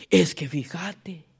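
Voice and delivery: slow, low confiding gossip; sly gossip. Retold or imitated. Pastor acting a gossip drawing a brother in, slow and low. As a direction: draw them in slowly.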